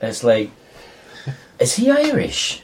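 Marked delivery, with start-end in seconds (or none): 1.6-2.6 high, slow mock Irish question; mock Irish lilt, pitched up. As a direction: ham up a stage Irish voice. Mocking Irish film clichés.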